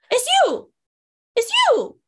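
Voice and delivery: high-pitched starstruck exclamation; mock excited recognition. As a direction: imitate a stranger thrilled to spot someone. Stranger recognises them at the gate.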